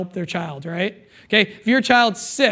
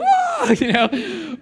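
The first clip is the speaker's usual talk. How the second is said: laughing; 6 semitones higher, much slower than usual. Real, not acted. Laughs at own joke.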